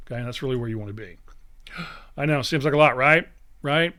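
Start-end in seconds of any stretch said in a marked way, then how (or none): 1.2-2.1 sharp, breathy mock gasp. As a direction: pretend to be startled by the list. Mock shock at long list.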